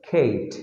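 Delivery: খেট with a gliding "ay", like Kate; long A sound. Shown as a demonstration. Teacher confirming the sound, খেট with long A. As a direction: draw the vowel of খেট into a low "ay". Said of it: "এই উচ্চারণ হচ্ছে".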